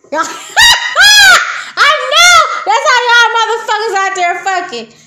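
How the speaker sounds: high, loud laugh-shouting. Real, not acted. Teasing viewers, laughs and shouts. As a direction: yell it through laughter.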